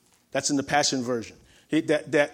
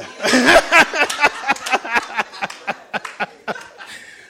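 1 long laugh; 8 semitones higher, 7 dB louder than usual. Real, not acted. Laughter after joke.

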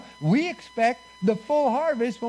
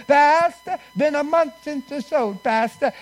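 higher, louder mock whine to the pastor; whiny churchgoer; 6 semitones higher, 5 dB louder than usual. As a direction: nag the pastor like an impatient member. Mocking complaining churchgoer.

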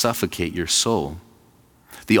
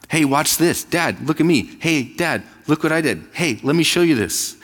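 put-on high, eager little kid calling for dad; a child begging his father to watch; imitating a kid; 8 semitones higher than usual. Pastor voicing kids seeking dad's attention, high and eager. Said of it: "what do kids say all the time".

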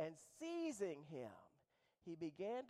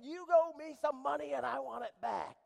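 loud, furious, higher-pitched demand; angry shout; 8 semitones higher, 15 dB louder than usual. Acted. Servant choking a fellow servant, furious shout. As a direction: shout him down for the money.

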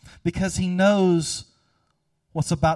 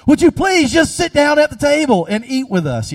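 much louder and higher, exasperated; exasperated shout; 11 semitones higher, 10 dB louder than usual. Retold or imitated. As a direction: yell at the kids to sit. Parent shouting at kids.

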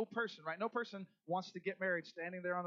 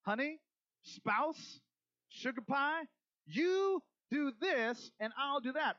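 mock sweet bargaining; 7 semitones higher, 3 dB louder, slower than usual. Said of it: "a contract says this".

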